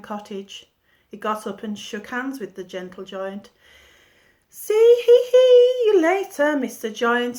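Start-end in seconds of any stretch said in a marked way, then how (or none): 4.5-7.4 very high, loud giggling goodbye. A narrator's reading. Jack giggles goodbye to the giant, high and loud. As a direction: giggle the words out. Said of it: "he giggled".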